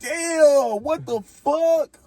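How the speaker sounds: high, drawn-out stunned voice. Retold or imitated. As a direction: act dazed after the hit. Imitating the stunned man.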